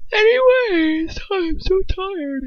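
high, slow, drawn-out sing-song; mock-weary whine. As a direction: stretch it out in exaggerated tiredness. Abruptly drops topic, mock whining.

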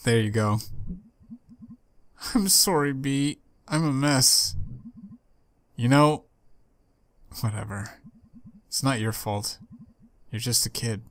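normal talking voice

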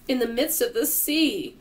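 high-pitched cry of alarm. A narrator's reading. James tells the others where they are, crying out. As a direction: call it out in dismay. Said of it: "cried James".

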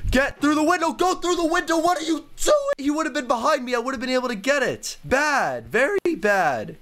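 high-pitched exasperated scolding; frustrated. Real, not acted. Player yelling at themselves to get through the window, frustrated. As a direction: squeal in frustration at a botched move. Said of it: "I am so frustrated right now".

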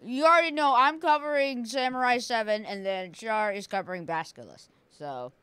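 ordinary delivery

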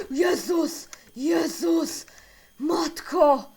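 loud, high scared shouts; startled out of your wits, shouting; real fright. Real, not acted. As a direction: cry out in sudden shock. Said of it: "Jak się wystraszyłem!".

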